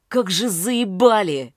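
loud angry snap; angry bark. A narrator's reading. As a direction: bark it out in fury. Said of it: "Рявкаю на них". (злобный рявк)

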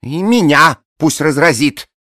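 high, loud, slow adoring gush; gushing blonde woman. A narrator's reading. Bleached blonde rhapsodising over her dead boxer, high and loud. Peroxide's voice.

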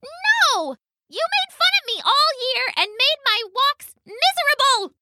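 tiny, very high aggrieved mouse; mouse calling back. A narrator's reading. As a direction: squeak out the grievance in a high little voice. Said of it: "the mouse called back".

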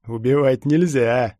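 slow, loud mocking echo; nasty sneer. A narrator's reading. Stormtrooper mocks the lad's plea, sneering nastily. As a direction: drag it out with menacing amusement. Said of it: "нехорошо усмехнулся штурмовик".

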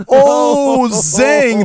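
high, loud, laughing oh zing; whooping zing at his own joke; gleeful zing. Real, not acted. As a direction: crow it through laughter. Punchline celebration.

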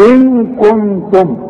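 إن كنتم with the ن hidden in a long nasal hum; إن كنتم with a held nasal ghunna. Shown as a demonstration. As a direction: hum through the نون in إن كنتم slowly. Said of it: "اوعى تنسى الغنة".

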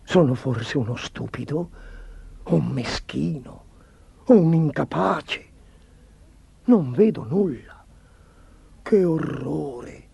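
slow, halting dismay; troubled self-questioning, ending very slow and appalled; horrified inner thought. A narrator's reading. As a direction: drag the words out in dread. Chancellor sees nothing, horrified thought.

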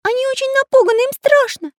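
high, anxious begging; pleading. A narrator's reading. Little artist tells captors children are scared, pleading. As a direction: plead in a loud piping voice. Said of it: "умоляюще проговорил Карандаш".

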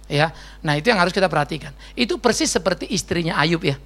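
normal talking voice

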